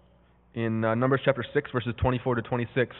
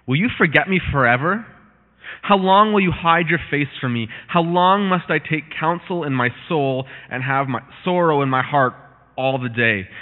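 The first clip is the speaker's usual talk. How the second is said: loud, raised psalm declamation; forceful scripture reading; 6 dB louder than usual. Acted. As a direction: cry the psalm out to God. Reads the psalm aloud forcefully.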